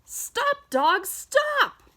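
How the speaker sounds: very high urgent call; calling out stop. Acted. Reading to a child, calling the dog to stop at the light. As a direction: yell at the dog to halt.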